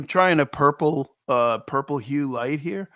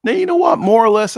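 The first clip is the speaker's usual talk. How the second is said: jumps in high and loud, keen to share; excited outburst; 7 semitones higher, 10 dB louder, faster than usual. Real, not acted. Cohost cuts in eagerly about his week, high and loud. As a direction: burst in with sudden high excitement.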